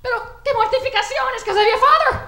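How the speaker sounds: high, loud, frantic wailing; freaking-out Mexican mother. Retold or imitated. Mother freaking out at her daughter's coming out, frantic and high. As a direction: panic like a mother hearing terrible news. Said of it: "My mother was all freaking out".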